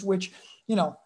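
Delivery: normal talking voice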